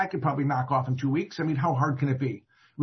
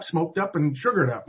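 ordinary delivery

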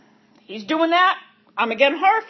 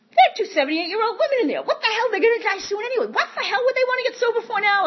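much higher, scoffing disbelief; incredulous scoff; 10 semitones higher than usual. Retold or imitated. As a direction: sneer at the idea, pitch way up. Mocking old women to mother.

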